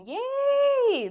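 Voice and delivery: high, loud drawn-out cheer. Real, not acted. Cheering that co-host and grandmother are on good terms, high loud cheer. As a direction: squeal it, high and held.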